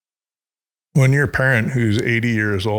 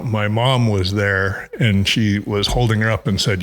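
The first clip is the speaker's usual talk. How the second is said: normal talking voice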